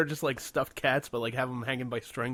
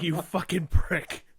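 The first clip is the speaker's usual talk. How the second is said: laughing curse; 6 semitones higher, 6 dB louder, slower than usual. Real, not acted. Laughing while retelling the curse.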